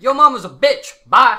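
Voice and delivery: loud mocking taunt yelled after someone; shout. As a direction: yell the insult after him. GM shouts kobold insult at fleeing bard.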